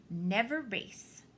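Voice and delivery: usual speaking voice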